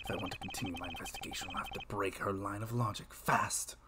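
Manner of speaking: quiet, fast inner monologue; whispered thought. Acted. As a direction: murmur it to yourself.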